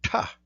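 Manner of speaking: "Ta" with the tongue curled toward the roof of the mouth; retroflex ta. Shown as a demonstration. Teacher demonstrating the retroflex "Ta". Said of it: "you make the T sound and put your tongue towards the roof".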